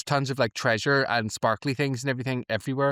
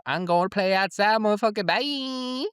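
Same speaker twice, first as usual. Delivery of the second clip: cocky Spike; 7 semitones higher than usual. Retold or imitated. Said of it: "he says".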